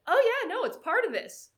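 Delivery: high, loud, breezy agreement; emphatic confirming. Retold or imitated. Racer assuring a nervous swimmer it's normal, loud emphatic agreement. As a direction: belt it out as if obvious.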